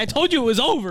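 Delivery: high, loud exclamation; mock outburst. Joking about the bag search being over, loud mock outburst. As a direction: cry it out in hammed-up exasperation. Acted reaction to the joke reveal.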